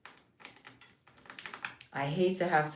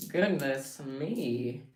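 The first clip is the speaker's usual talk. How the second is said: goodness stretched out slowly; slow, prim pearl-clutching goodness; slow drawn-out dismay; 2 semitones lower, much slower than usual. Acted. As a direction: draw out goodness in mock dismay. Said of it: "Let me clutch my pearls".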